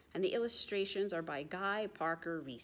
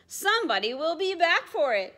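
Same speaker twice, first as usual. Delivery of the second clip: high, louder motherly caution; mother penguin; 9 semitones higher, 7 dB louder than usual. Acted. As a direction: caution the child firmly. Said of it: "says his mother".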